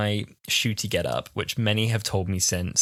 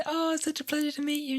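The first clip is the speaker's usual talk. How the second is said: high, softer starstruck gush; starstruck fan; 6 dB quieter than usual. Retold or imitated. Fan retelling meeting Billie Piper, starstruck and high. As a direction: squeal it politely, overcome. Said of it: "I said".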